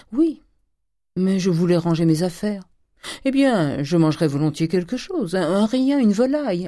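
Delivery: high, airy dandy rising in pitch; affected young dandy. Acted. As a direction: play a pampered Parisian ordering lightly.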